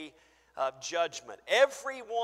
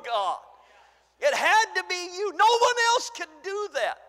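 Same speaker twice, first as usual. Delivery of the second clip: higher, louder, exultant praise; fervent believer; 9 semitones higher, 10 dB louder than usual. Acted. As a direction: shout your miracles to God. Acted plea at judgment.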